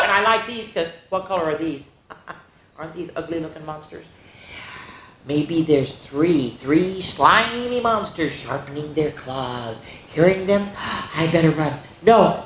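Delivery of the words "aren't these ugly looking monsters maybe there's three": usual speaking voice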